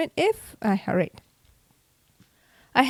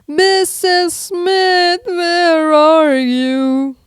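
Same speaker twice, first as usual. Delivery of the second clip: slow, drawn-out scream, higher and louder; 7 semitones higher, 6 dB louder, much slower than usual. A narrator's reading. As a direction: bellow her name across the bridge. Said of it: "I screamed at the top of my lungs".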